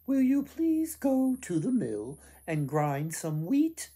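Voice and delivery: put-on a mother politely sending her child to the mill; mother asking. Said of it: "the mother asked".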